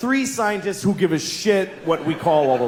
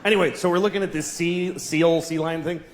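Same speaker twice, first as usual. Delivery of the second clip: usual speaking voice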